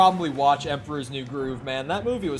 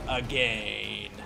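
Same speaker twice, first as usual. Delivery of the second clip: lower, drawn-out villain growl on "Again"; menacing resurrected villain; villain voice from Blood; game villain voice; 7 semitones lower, 6 dB quieter than usual. Shown as a demonstration. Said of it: "started doing the voice".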